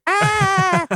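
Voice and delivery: high-pitched strained cry; high yell. Acted. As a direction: let out a failed high holler. Attempting the man voice.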